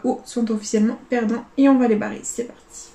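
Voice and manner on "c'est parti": chuchoté. Real, not acted.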